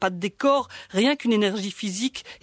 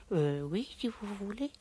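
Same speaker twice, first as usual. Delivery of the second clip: put-on softer, hesitant little girl; timid little girl; 10 dB quieter than usual. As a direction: give in reluctantly, barely audible. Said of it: "elle est une petite fille de huit ans".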